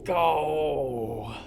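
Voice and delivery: loud high yell of urging; excited shout. Real, not acted. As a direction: shout it at the screen. Urging a jump in game.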